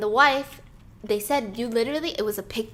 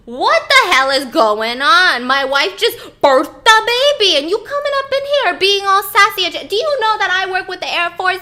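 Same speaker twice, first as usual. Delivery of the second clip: riled up; 8 semitones higher, 12 dB louder than usual. Retold or imitated. Captain yelling at police, riled up and loud. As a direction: puff up like an offended husband defending his wife. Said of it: "He's getting all riled up".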